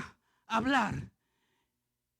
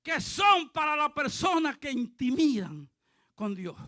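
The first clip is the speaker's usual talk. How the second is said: ordinary talk, nothing marked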